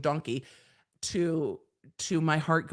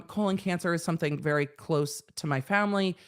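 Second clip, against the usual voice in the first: ordinary delivery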